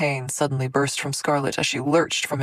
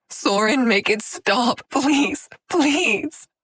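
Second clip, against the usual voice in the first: begging; 10 semitones higher, 5 dB louder, much slower than usual. A narrator's reading. Begging in anguish.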